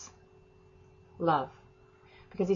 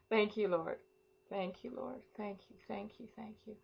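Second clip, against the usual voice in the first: softer and softer thanks, sinking to a murmur; hushed fading thanks to the Lord; fading prayer; 12 dB quieter than usual. Real, not acted. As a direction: let each thank you fade quieter into prayer.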